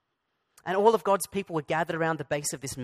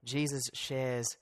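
softer and lower, slowing down; hushed intimate turn; 4 semitones lower, 7 dB quieter than usual. Real, not acted. Preacher turning to his congregation, hushed and lower. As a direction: draw the listeners close.

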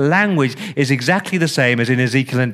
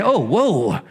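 mock awe; 4 semitones higher than usual. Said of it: "you go".

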